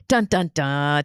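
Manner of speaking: mock suspense sting. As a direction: sing a cheesy cliffhanger sting.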